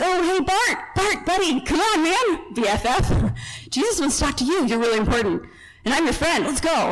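high-pitched, over-friendly buddy act; butter him up like an old pal; sudden chummy turnaround. Retold or imitated. Crowd suddenly befriending the beggar Jesus called, mock chummy. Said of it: "everybody's tune changed".

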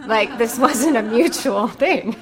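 laughing through the words. Real, not acted. Laughing as she tells of the Lord not smiling back. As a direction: chuckle at her own story.

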